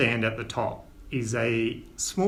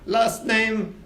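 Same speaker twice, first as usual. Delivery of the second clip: higher, grand ship captain; captain Winters; 3 semitones higher than usual. Acted. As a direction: welcome passengers aboard with flourish. Captain checks tickets.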